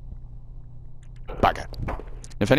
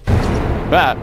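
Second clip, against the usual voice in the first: startled cry; 7 semitones higher, 6 dB louder than usual. Real, not acted. Startled by something in game.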